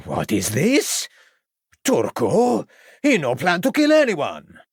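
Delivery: a frightened violinist denying the plot; alarmed foreigner. Acted. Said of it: "replied the alarmed violinist".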